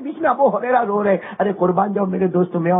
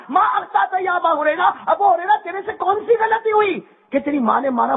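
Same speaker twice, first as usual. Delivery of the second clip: called-out question; 8 semitones higher than usual. Acted. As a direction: press the question out to the crowd. Repeated loudly to crowd.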